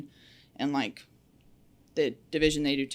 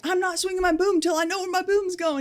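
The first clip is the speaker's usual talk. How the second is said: much higher put-on voice pushing back; mock defiant reply; 14 semitones higher, 4 dB louder than usual. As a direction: play yourself stubbornly refusing. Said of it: "And I'm like".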